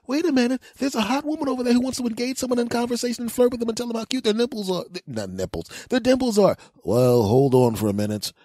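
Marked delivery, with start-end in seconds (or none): 0.1-4.8 high, rushed comic urgency; mock alarm. Hero sensing a woman who wants to flirt, urgent. Superman skit, sensing damsel in need. 5.9-6.6 high, rushed comic urgency; mock alarm. Hero sensing a woman who wants to flirt, urgent. Resumes the alarmed voice after slip. 6.8-8.3 mock-heroic resolve. Clark Kent preparing to change.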